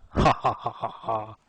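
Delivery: mild hyena cackle; spooky ha ha ha; eerie laugh. Acted. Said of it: "laughing eerily".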